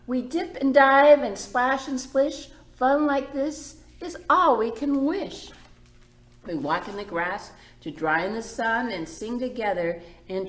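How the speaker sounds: bright, lilting story read; gentle read-aloud for the little ones; reading to children. A narrator's reading. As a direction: read it like a picture book to kids. Reads picture book aloud.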